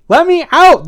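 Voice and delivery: high, loud trapped scream. A narrator's reading. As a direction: yell to be let out. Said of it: "the pigeon screamed".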